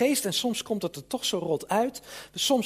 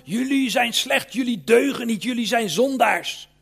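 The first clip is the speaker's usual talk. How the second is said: louder and higher, accusing the crowd; condemning preacher; 4 semitones higher, 7 dB louder than usual. Retold or imitated. Imitating a pulpit condemning the congregation, loud and accusing. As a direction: thunder it like a harsh judging preacher.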